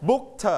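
"booked" as book, then a released "tuh"; separated final t. Shown as a demonstration. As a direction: sound out the t at the end of "booked". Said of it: "we pronounce t".

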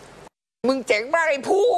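high, slow, drawn-out boast; proud self-praise. Retold or imitated. Father congratulating himself on keeping calm, high and drawn out. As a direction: crow over your own victory.

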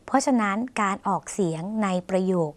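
usual speaking voice

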